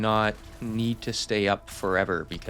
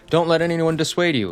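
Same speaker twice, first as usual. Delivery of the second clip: quoted viewer comment, voice raised higher and louder; mock earnest viewer; 8 semitones higher, 6 dB louder than usual. Creator quoting a viewer's encouraging comment, mock earnest. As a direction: chirp it like an eager supportive commenter.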